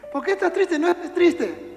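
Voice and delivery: hammed-up cheery comforting; mock shallow consoler. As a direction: play the friend who thinks words fix it.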